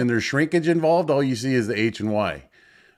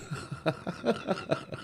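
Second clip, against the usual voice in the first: high breathy giggle; high laugh; 7 semitones higher, 13 dB quieter than usual. Real, not acted. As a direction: laugh at own joke. After own joke.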